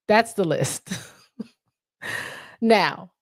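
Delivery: ordinary delivery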